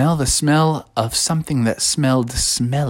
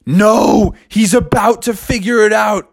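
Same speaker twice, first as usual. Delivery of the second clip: high, urgent cry, loud start; alarmed Froggy; 7 semitones higher, 3 dB louder than usual. Acted. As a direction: cry out a desperate warning. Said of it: "And Froggy said".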